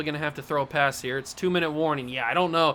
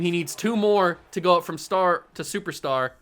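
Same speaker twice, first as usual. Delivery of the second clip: normal talking voice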